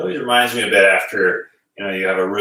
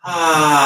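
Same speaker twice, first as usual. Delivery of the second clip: a high, louder "Ah" of realization; sigh of realization; 7 semitones higher, 4 dB louder than usual. Shown as a demonstration. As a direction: sigh as it dawns on you. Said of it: "breathe a sigh of realization".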